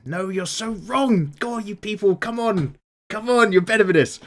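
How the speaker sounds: high, drawn-out exasperated protest; mock outrage. Host disputing a pro-dub comment, mock-outraged.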